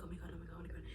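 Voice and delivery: barely voiced, frozen in dread; panicked near-silent oh my God. Panicking while checking email.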